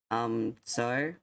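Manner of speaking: usual speaking voice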